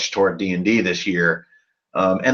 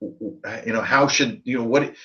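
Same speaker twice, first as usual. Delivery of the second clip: normal talking voice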